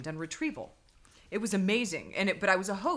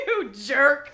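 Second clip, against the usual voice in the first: laughing burst; cracking up loud; 4 semitones higher, 9 dB louder than usual. Real, not acted. Laughing at friend.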